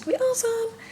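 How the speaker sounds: high gushing delight; excited squeal. Real, not acted. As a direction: squeal it with glee. Excited about the Vatican.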